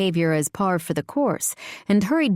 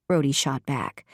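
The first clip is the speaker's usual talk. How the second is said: ordinary delivery, nothing marked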